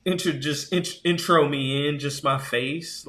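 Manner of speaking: high, hammed-up objection, loud at first; mock indignant protest. Zoom guest whose camera came on uninvited, comically indignant. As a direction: protest as if caught off guard.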